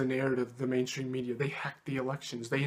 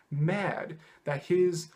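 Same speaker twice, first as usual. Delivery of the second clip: normal talking voice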